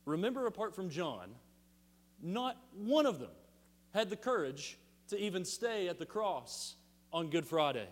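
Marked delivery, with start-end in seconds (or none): none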